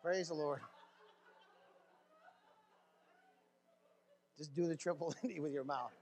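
low, laughing aside; talking through a laugh; real laughter. Real, not acted. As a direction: chuckle through the words. Laughing at own joke.

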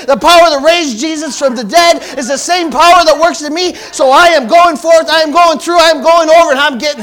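loud, high, triumphant declaration; full-force preaching. Acted. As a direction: build it to a victory shout.

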